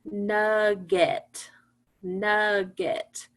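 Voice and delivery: NUGGET said slowly as two level syllables, nug-get. Shown as a demonstration. Teacher showing class how to count syllables in nugget, slow and separated. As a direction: stretch both syllables of nugget on a steady pitch. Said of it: "say the word slowly".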